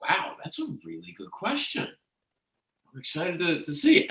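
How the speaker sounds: imitating impressed onlookers, high-pitched; light, high mock amazement at someone's question; impressed audience. Room reacting to her good question, imitated impressed wonder. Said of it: "And they would say".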